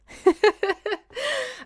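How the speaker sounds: high-pitched real laugh. Real, not acted. Streamer laughing at her own joke about her dream job, high laugh.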